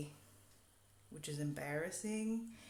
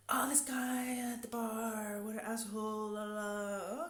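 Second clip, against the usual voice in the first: imitated angry Spanish guest; 6 semitones higher, 4 dB louder than usual.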